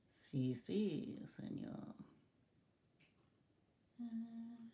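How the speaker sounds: barely voiced, very soft murmur; whispered to self. Real, not acted. Reader hunting for C C's question, whispering to self. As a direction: whisper the name under your breath while searching.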